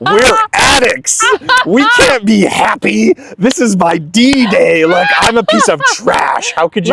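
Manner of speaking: high, loud mock-angry rant; hammed-up indignation at happy fellow addicts; retold outrage. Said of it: "and I was pissed".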